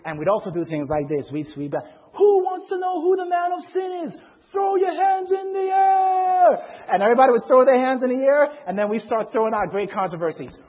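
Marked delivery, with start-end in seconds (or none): 2.1-6.6 high, loud, sustained crowd call; club hype man. Retold or imitated. Hip hop messengers to a club crowd, hollering like emcees. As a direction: holler it to pump up the whole club.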